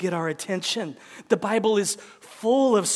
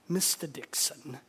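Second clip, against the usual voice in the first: Mister Dixon in a lower, softer put-on accent; 6 semitones lower, 10 dB quieter than usual. Missionary answering the man who warned him, put-on accent. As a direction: say the name again in an improvised foreign accent. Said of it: "he probably said it with an accent".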